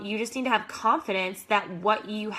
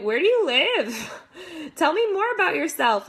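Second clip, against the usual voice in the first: imitating a nosy stranger, higher, with a laugh; mock pushy stranger; 6 semitones higher, 4 dB louder than usual. Coach mocks a pushy rep grilling a stranger, hammed-up and laughing. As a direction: play the stranger who pries too fast, half laughing. Mocking an overeager stranger's questions.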